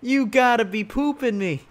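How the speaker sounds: usual speaking voice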